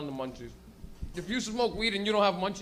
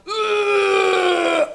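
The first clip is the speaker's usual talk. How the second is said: long, high, loud roaring retch; loud drunk retch; 12 semitones higher, 13 dB louder than usual. Shown as a demonstration. Drunk puking at night, loud roaring retch. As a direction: bellow it like a drunk vomiting. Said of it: "and then there's".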